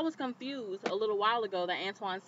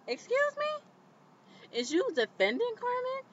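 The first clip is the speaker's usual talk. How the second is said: drawn-out, sky-high "Excuse me?" full of attitude; indignant; slower than usual. Real, not acted. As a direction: stretch it high and slow, outraged. Indignant at the producers.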